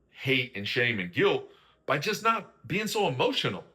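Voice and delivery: ordinary delivery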